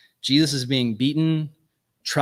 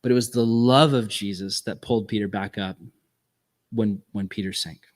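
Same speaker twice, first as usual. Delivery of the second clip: normal talking voice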